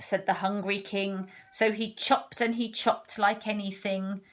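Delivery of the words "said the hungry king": ordinary delivery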